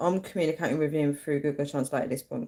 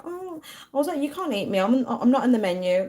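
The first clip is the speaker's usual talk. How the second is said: innocent; 8 semitones higher than usual. Retold or imitated. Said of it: "being like, innocent, being like".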